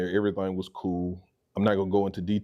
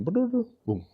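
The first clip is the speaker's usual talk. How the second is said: high-pitched mouth-made ringtone; imitated phone ring. Phone rings on the drive home, imitated ringtone.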